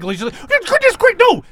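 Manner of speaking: high, alarmed squeak; Ingenue panicking. Acted. Ingenue stopping the interview, high and alarmed. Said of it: "Ingenue is like".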